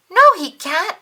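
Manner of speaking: high loud no; loud blurt. A narrator's reading. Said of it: "said Ernie".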